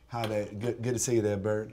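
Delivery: put-on Georgia Southern accent. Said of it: "let's do Georgia Southern".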